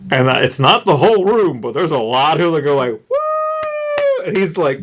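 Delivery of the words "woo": a high whooping cheer; mock crowd cheer. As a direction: cheer like a rally crowd, much higher.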